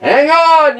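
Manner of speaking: high loud warning; yell. Acted. Sam yells at passengers as the train jerks. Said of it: "yelled Sam".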